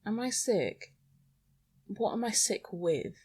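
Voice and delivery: usual speaking voice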